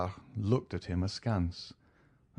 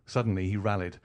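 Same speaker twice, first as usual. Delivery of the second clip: ordinary delivery, nothing marked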